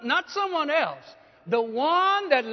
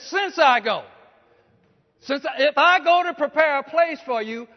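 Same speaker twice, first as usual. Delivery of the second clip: raised, higher proclamation of the verse; loud preaching; 4 semitones higher, 8 dB louder than usual. Acted. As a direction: declaim the verse loudly. Preacher recites the promise loudly.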